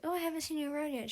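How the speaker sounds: high, fast and eager; eager Finch. Retold or imitated. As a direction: blurt it out quickly and brightly. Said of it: "Finch is like".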